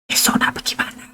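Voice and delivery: breathy unvoiced whisper, hushed so the child can't hear; a wife's hushed secret whisper. Shown as a demonstration. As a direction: whisper it to your husband in secret. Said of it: "So, dia bisik".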